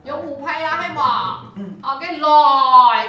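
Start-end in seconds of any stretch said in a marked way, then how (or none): 1.8-3.1 much louder, slow cue to start; loud drawn-out cue. Real, not acted. Conductor cueing the tenors to begin. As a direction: call the choir in with full breath. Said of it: "撑好哦".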